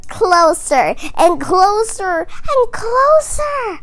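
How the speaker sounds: high, loud spooky build; creepy voice. Acted. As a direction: creep it closer and closer. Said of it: "Her voice is creepy now".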